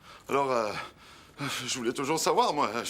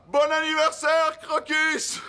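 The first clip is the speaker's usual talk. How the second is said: much higher, louder birthday cheer; joyful shout; 4 dB louder, slower than usual. Acted. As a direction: shout it all together, delighted. Family shouts birthday greeting. (cri joyeux d'anniversaire)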